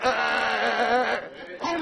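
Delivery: soft, stuck stammering "eh"; wordless stammer, too overcome to get words out; imitated stammering. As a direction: act out trying to speak and failing. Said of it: "zo vol van de geest dat ze niet kon uitspreken".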